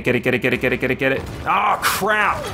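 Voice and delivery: ordinary talk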